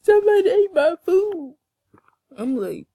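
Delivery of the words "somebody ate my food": high whiny mock sob, louder; mock crying. Mocking friend crying about her food with a whiny wail. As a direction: wail it like a fake tantrum. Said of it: "You be crying talking about".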